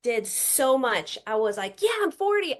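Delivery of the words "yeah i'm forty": high, proud "Yeah, I'm forty"; proud cheer. Retold or imitated. Woman voicing her pride at turning forty, high cheer. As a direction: celebrate the age out loud.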